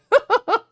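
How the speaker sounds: short high-pitched laugh; a bright burst of laughter. Real, not acted. Laughing at her own joke.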